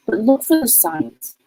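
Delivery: normal talking voice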